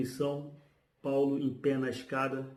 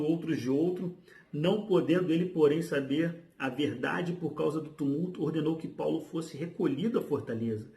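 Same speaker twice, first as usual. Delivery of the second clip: higher-pitched, projected reading aloud; emphatic reading with raised pitch; dramatic scripture reading; 4 semitones higher, 4 dB louder than usual. Acted. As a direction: declaim the Bible passage to the congregation. Reads scripture passage aloud.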